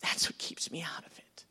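whispered confession; quiet earnest whisper; hushed. Real, not acted. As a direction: drop to a whisper for weight. Repeated hushed conclusion.

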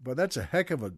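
usual speaking voice

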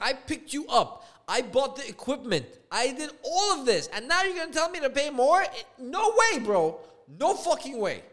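loud, high indignant rant; flipping out. Retold or imitated. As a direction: build to an outraged yell, then refuse firmly. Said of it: "flipping out".

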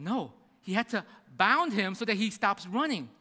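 ordinary talk

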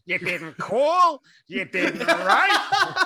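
very high, loud, slow guilt-trip; hammed-up wail breaking into laughter; exaggerated character voice. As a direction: stretch the complaint out, shrill and overdone. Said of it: "the payoff for that voice".

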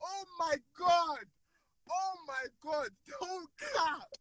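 worked-up, wailing exasperation; overwrought, crying out in protest; losing composure. Real, not acted. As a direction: plead in disbelief, words stretched out. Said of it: "I can't contain my shit".

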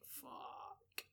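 whispered curse, barely any voice; stunned whisper. Retold or imitated. Storyteller replaying his reaction to her confession, stunned whisper. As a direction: curse under your breath in mock dismay.

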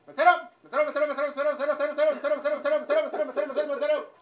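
high, loud repeated calling; yelling for the waiter. Acted. Impatient customer yelling for the waiter. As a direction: shout for the waiter over and over.

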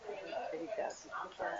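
breathy whispered aside; whispering. Real, not acted. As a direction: whisper it to yourself. Aside after planning joke.

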